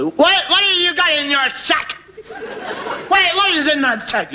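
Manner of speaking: put-on high, slow drunken question. One drunk pestering another about his sack, high drunk voice. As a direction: play a sozzled drunk.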